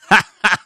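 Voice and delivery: sudden loud laugh; loud burst of laughter. Real, not acted. Laughing at own joke.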